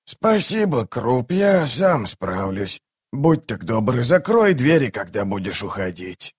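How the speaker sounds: put-on slow old man's voice, fading at the end; drawn-out, quavery old retainer; grumbling old servant. A narrator's reading. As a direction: grumble wearily as an elderly servant. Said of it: "проворчал Роальд".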